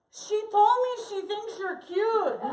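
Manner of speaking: high, loud, slow call-out; mischievous drawn-out holler; Ovidio. Retold or imitated. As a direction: tease it out loudly to a stranger. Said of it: "Ovidio is holding the camera up and goes".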